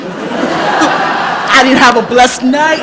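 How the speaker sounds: loud, high, laughing indignation; cracking up while complaining loudly; laughing protest. Real, not acted. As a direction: protest through big laughs. Laughing protest at informant's free blessing.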